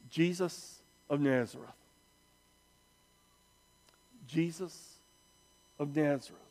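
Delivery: grave, quiet pronouncement; hushed reveal. Real, not acted. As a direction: let the name land quietly, with long pauses. Solemn reveal of the answer.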